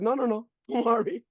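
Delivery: laughing through no no no; laughing reassurance. Retold or imitated. Upline laughing off his worry about ninety calls. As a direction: reassure him while chuckling.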